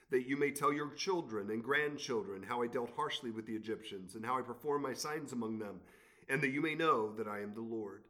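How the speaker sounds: lifted, measured Bible reading; scripture reading. Acted. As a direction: read God's words to Pharaoh with weight.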